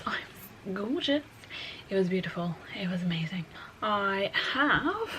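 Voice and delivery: soft breathy whisper; hushed aside to the camera. Real, not acted. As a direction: drop to a whisper mid-sentence. Aside while showing breakfast.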